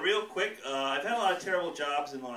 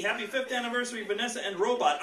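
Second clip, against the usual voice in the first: order read-back; 7 semitones higher, 5 dB louder than usual. Pretend. Clerk reading the cake order back over the phone. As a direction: read back slightly higher and louder like a clerk. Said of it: "taking that order on the phone".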